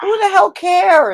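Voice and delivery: high, loud, drawn-out exasperation; exasperated. Real, not acted. Host reacting to the caller's complaint, exasperated. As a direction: cry it out slowly, fed up with the caller.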